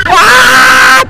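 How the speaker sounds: shrieks and yelled warnings; full-voiced panicky yelling; real ride screaming. Real, not acted.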